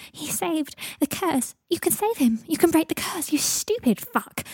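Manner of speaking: high, distraught tearful outburst; crying. A narrator's reading. Pansy, crying, tells Harry he can save Draco, distraught. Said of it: "She had started to cry again".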